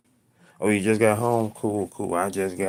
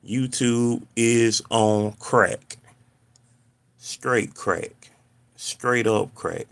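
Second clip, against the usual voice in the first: drawn-out complaint; 4 semitones higher, 8 dB louder, much slower than usual. Real, not acted. Complaining about YouTube.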